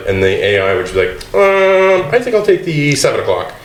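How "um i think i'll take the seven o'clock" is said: human-sounding AI caller. Retold or imitated. AI booking a restaurant table by phone, fake-human hesitancy. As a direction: imitate an AI faking human hesitation. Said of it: "just to make it sound more human".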